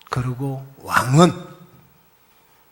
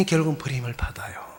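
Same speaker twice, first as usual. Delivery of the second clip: fast, soft whisper; whispered warning; 8 dB quieter, faster than usual. Real, not acted. Pastor whispering the warning to the congregation. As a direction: hush it like a grave warning.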